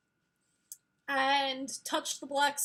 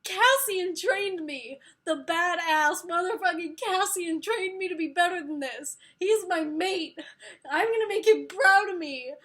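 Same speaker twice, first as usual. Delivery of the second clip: fired-up outburst; 5 semitones higher, 7 dB louder than usual. Retold or imitated. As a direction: shout her mate's praise to get back up. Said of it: "And she's like".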